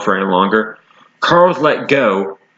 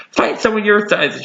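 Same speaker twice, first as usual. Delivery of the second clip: higher, forced bravado; mock brave shout; 10 semitones higher than usual. A narrator's reading. Carlos faking courage at the wolf, high shout. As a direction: try to sound braver than you are. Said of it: "shouted Carlos, trying to act brave".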